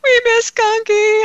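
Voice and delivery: imitating kids. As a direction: mimic the grandkids missing her. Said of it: "they were like".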